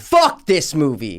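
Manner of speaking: loud, drawn-out angry blurt; fed-up, emphatic outburst; exasperated outburst. Real, not acted. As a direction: burst out with it, slow and loud. Outburst of hatred for the film.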